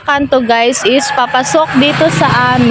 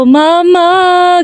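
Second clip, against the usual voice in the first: imitating crying child; 7 semitones higher than usual. Lost four-year-old calling for her mother, mock wail. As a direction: wail it like a lost little girl. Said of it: "grabe yung iyak ko".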